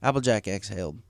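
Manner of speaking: plain reading voice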